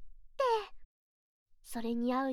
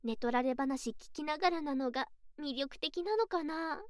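usual speaking voice

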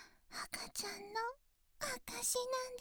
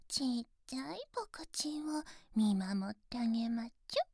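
ordinary delivery

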